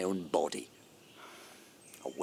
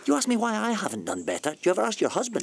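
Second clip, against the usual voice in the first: much higher, louder, fast and sharp; angry retort; 12 semitones higher, 5 dB louder, faster than usual. Acted. Man turning the question back on her in anger.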